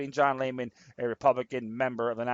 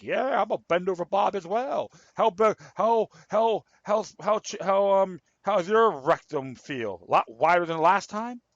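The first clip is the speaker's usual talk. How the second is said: higher-pitched sarcastic mimicry; mock him in a raised, halting voice; sarcastic mock quote; 4 semitones higher than usual. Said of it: "I'm just being sarcastic here".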